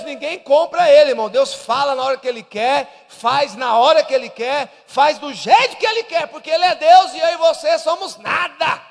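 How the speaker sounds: loud, rising preacher's cry; fiery pastor preaching loud; preaching at full force. Acted. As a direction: thunder it out to the congregation.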